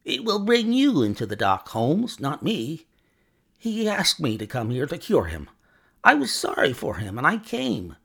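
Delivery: cornered villain protesting in a high pitch; high, snarling voice. A narrator's reading. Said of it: "cried the high, snarling voice".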